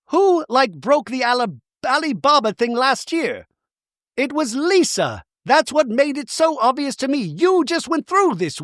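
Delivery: high, insistent accusing; mock indignation. Bronwyn arguing that Lisa is the culprit, indignant. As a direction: imitate someone heatedly making her case. Acting Bronwyn making her case.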